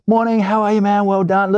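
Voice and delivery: high-pitched, over-friendly greeting; mock friendliness. Crew greet the actor after his scene, mock effusive and high. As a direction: imitate gushing, sing-song pleasantries.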